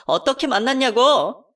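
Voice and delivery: slow, high and loud, yelling the question again; furious repeat. Acted. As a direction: hammer each word out. (대답 안 하는 남편에게 다시 소리치는 아내)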